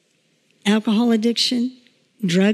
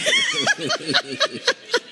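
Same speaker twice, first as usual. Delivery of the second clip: high laugh; 5 dB quieter than usual. Real, not acted. Teller overcome retelling sweet moment.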